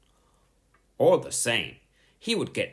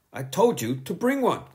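snapped; 10 semitones higher, 4 dB louder than usual. A narrator's reading. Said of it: "snapped Miss Boot".

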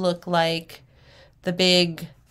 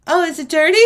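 mock innocent question; 9 semitones higher, 8 dB louder, much faster than usual. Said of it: "almost felt like saying to her".

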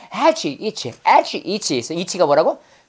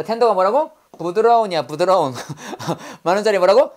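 usual speaking voice

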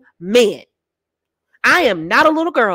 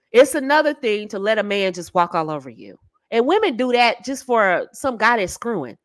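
ordinary talk, nothing marked

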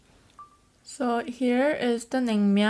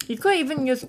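ordinary delivery

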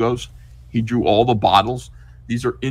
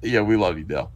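ordinary delivery

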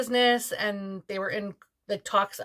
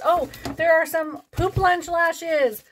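high, drawn-out excited oh; light up at the find; slow delighted discovery; 7 semitones higher, 4 dB louder, slower than usual. Real, not acted. Discovering novelty lashes.

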